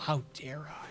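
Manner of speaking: whispered mock outrage; sarcastic whisper. Cashier mocking the customer in a whisper. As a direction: breathe it as a sarcastic aside.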